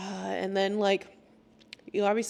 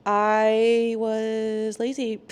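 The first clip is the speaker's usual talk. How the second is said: slow, stretched-out admission; long-held stalling start; drawn-out confession; 4 dB louder, much slower than usual. Retold or imitated. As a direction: stretch the first word before confessing. Acts out her sheepish confession.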